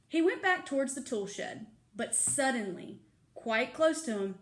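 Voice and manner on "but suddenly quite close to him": ordinary delivery, nothing marked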